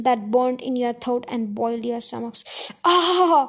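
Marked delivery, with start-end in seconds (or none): 3.0-3.4 high, loud gasped "ah". A narrator's reading. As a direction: gasp out after a fiery gulp. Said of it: "gasped the smallest fox".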